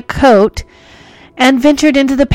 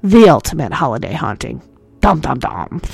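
low ominous horror-host voice; deep spooky voice; 8 semitones lower than usual. Pretend. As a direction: drop it deep and dramatic. Spooky dramatic sting.

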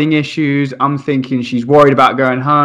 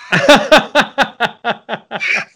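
squealing laugh; high burst of laughter; 8 semitones higher than usual. Real, not acted. Laughing at the child's verdict.

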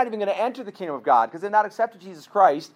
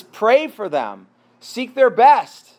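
each word slow and weighty, building louder to best; slow emphatic command; 2 semitones higher, 6 dB louder, much slower than usual. Acted. Preacher giving Jesus' command to the congregation, slow and emphatic. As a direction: lay the command down slow and firm.

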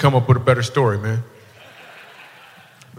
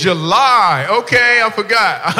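loud, high-pitched dawning understanding; big drawn-out "oh, now I get it"; mock realisation; 7 semitones higher, 8 dB louder, slower than usual. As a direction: exclaim it slowly, playing up the discovery. Mock sudden realisation of the accent.

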